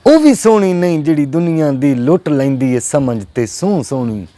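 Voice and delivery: emphatic Punjabi verse with drawn-out key words; poetry recital. Acted. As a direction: declaim it to a mushaira crowd.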